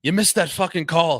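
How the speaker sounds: high, loud yell at the ref; a coach yelling from the bench; angry coach. Retold or imitated. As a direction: coach yelling at the referee, mock angry shout. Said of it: "He goes".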